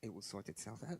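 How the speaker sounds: soft, settled reassurance; quiet, unhurried comfort; calm. Retold or imitated. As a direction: say it with complete trust. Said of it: "Very calm".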